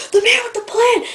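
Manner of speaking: loud, very high playful holler; yell. Retold or imitated. Student shouting through cafeteria doors at the janitor, yelling. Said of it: "and I yelled".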